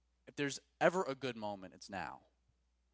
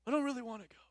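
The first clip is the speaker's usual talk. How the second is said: reluctant friend; 11 semitones higher, 3 dB louder, much faster than usual. Pretend.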